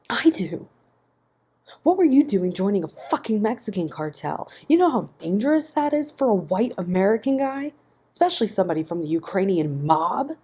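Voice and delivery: loud, high, outraged; angry shouting. A narrator's reading. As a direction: yell it back at him, horrified. Ian shouting back in fright.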